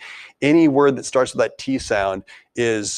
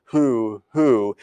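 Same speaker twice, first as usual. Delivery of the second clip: owl hoot; much slower than usual. Retold or imitated. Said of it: "sound like you're an owl saying".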